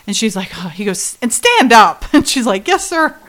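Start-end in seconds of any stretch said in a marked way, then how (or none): 1.2-2.0 loud, sharp command; barked order. Retold or imitated. Retelling Billy ordering her to stand, barked order. Acted order in retold scene. 2.7-3.1 high, playful yes-sir; mock obedient reply. She answers Billy's order, mock obedient reply.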